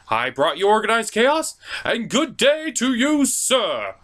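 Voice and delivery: normal talking voice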